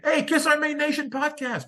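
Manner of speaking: high, excited mock call from the crowd; imitating fans screaming. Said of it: "people were screaming".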